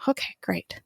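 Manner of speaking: whispered dry "Okay, great"; sarcastic whisper. Retold or imitated. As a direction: mutter it under your breath, unimpressed. Sarcastic aside to listener.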